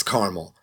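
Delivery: caramel in two syllables, CAR-mul; corrected caramel. Retold or imitated. Starbucks worker correcting the customer back, caramel as CAR-mul. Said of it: "they always correct me".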